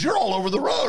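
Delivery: light imitation of a scolding deputy; imitated cop scolding. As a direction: retell the cop telling him off. Imitating the officer.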